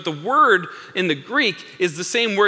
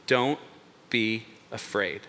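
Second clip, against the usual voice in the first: low, soft, slow and spaced out; calm reassurance; 7 semitones lower, 7 dB quieter, slower than usual. Acted. Jesus to terrified disciples at sea, calm reassurance. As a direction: gently settle them, word by word.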